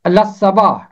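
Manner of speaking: لصباح said lassaba, lam merged into the sad; correct pronunciation. Shown as a demonstration. Teacher gives the correct way to say لصباح. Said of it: "اس کا تلفظ ہے".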